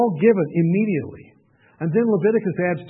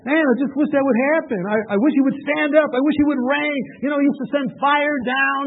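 mock longing plea; 6 semitones higher than usual. Voicing a frustrated believer.

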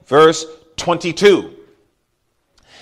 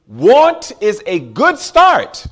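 louder, very slow and deliberate; slow preaching emphasis; 3 semitones higher, 4 dB louder, much slower than usual. Real, not acted. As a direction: drive it home slowly over music. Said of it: "Don't lose that".

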